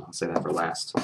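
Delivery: ordinary talk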